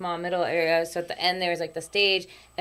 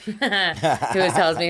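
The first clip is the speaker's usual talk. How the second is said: ordinary talk, nothing marked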